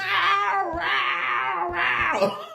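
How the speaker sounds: long, high-pitched mock wail; drawn-out hammed-up yowl; imitated yelling. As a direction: howl like someone yelling down a stairwell. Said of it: "yelling and".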